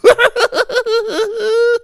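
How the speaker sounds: high, wild laugh of delight; whooping laugh while flying; thrilled laugh. A narrator's reading. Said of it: "Laughing from the thrill".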